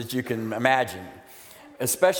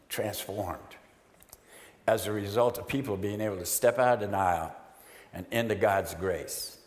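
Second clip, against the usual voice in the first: soft and halting with long pauses; moved, emotional; 3 semitones lower, 4 dB quieter than usual. Real, not acted. Speaker testifying about lives transformed by the ministry, deeply moved. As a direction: hold back real feeling while testifying. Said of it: "the Spirit's really beating me up here".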